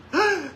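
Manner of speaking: sharp high, short gasping cry; startled cry. Retold or imitated. Pastor imitates the freed woman waking, a high startled cry. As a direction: jolt awake with a cry.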